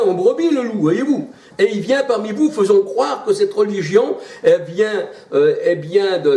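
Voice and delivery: fervent preaching. Acted. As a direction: preach it like a warning from the pulpit. Fiery sermon on false religion.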